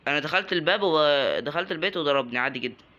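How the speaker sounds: ordinary delivery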